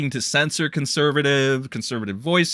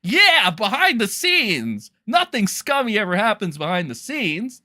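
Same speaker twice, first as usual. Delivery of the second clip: sarcastic mock; 7 semitones higher than usual. E-celebs mocked for backroom deals, sarcastic. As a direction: sneer at fake diplomacy. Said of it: "where it's like".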